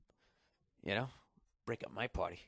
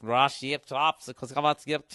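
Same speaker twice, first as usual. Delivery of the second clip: louder, bouncy Icelandic-accented radio host, hammed up; mock Icelandic accent; 3 semitones higher, 6 dB louder than usual. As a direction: imitate the Icelandic host's chirpy accent, loosely. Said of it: "I can't even do the accent".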